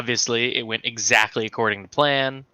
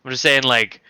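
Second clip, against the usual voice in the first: normal talking voice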